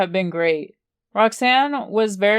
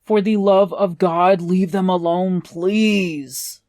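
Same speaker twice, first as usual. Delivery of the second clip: whiny. A narrator's reading. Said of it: "Ryder whines out".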